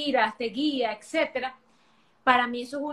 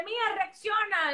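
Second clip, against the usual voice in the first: higher, slow pleading push to react; urging wake-up call; 8 semitones higher than usual. Retold or imitated. To women stuck in toxic cycles, a higher, slow urging plea. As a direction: shake a friend awake with the words. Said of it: "me provocaría decirle".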